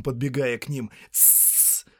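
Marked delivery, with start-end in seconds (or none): 1.1-1.9 sharp unvoiced shh; hissing shush. A narrator's reading. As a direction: hiss for silence. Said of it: "зашипел Голлум".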